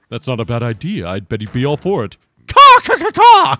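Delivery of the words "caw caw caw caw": loud screeching crow caws; shrill screeching bird woman. Acted. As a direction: shriek the caws high like a bird. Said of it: "How the fuck did I voice her?".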